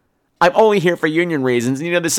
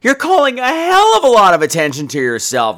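usual speaking voice

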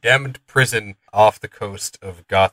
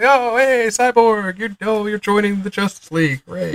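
mock cheerful welcome; 9 semitones higher than usual. Mocking the heroes welcoming Cyborg.

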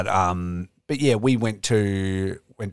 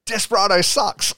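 teasing jab; 12 semitones higher, 6 dB louder than usual. Retold or imitated. Robbie teasing the fan.

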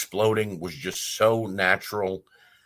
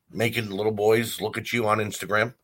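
ordinary talk, nothing marked

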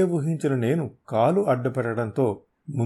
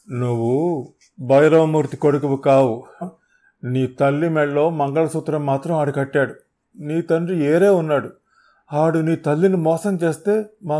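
loud, higher hurried telling; frantic confession; 4 semitones higher, 6 dB louder than usual. Acted. Shaken thug spilling the secret, loud frantic confession. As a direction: blurt it all out.

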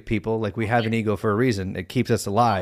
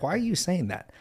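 soft, higher inner voice; gently questioning yourself; 8 semitones higher, 4 dB quieter than usual. Retold or imitated. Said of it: "That quiet, questioning voice".

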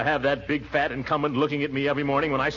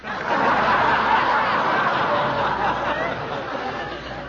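embarrassed high giggle; 3 dB louder than usual. Acted. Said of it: "You boys must have been very fond".

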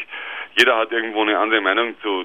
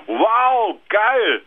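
excited; 10 semitones higher than usual. Real, not acted. Finds cheap old domain.

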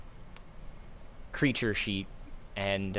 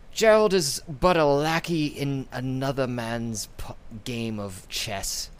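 slow, loud, higher scheming villain; 7 semitones higher, 4 dB louder than usual. Acted. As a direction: tempt them like a crime lord. DM voices Ferris.

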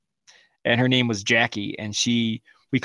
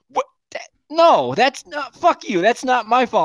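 high, loud sputtered denial; heated, high-pitched retort; mock indignant sister; 10 semitones higher, 4 dB louder than usual. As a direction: imitate a sister protesting the blame.

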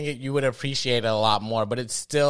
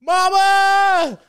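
a soldier's anguished cry for his mother, screamed far higher and louder; retold desperate yell; 11 dB louder than usual. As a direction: wail it out high and raw, like a dying soldier. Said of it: "he just yells".

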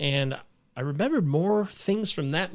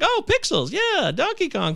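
high, lightly excited recalling; mock excitement; 12 semitones higher, slower than usual. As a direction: pitch it up like a kid spotting old games. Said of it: "I was like".

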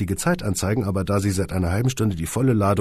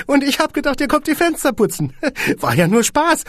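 very high, loud squeaky joking boss; squeaky little man; 5 dB louder than usual. Acted. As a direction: squeak and giggle at the joke. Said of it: "piepste das Männchen".

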